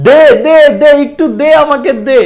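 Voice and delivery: high, loud, insistent begging; mock demanding plea. Teacher mimics someone begging "give me", loud mock plea. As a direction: plead loudly and urgently, pitched right up.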